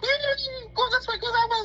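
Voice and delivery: very high, squealed panic imitation; mock scream. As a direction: squeal it like someone scrambling to escape. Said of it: "he's just screaming".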